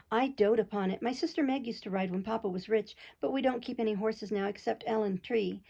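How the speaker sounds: usual speaking voice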